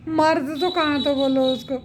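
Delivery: can't stop laughing while mocking. Real, not acted. Vlogger mocking Sachin's house, laughing through words. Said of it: "मेरे को तो बहुत हंसी आ रही है".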